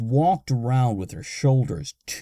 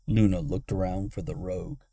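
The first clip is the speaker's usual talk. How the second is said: ordinary delivery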